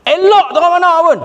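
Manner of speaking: high-pitched, insistent dismissal; emphatic dismissal. Acted. Preacher rejects any teaching against Quran and sunnah, emphatic dismissal. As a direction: pitch it right up, waving the argument away. Repeats the acted firm dismissal.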